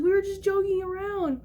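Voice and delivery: retold crying. Child excusing herself to angry mom, mock crying. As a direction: imitate a kid pleading through tears. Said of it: "started profusely crying 'cause I was like".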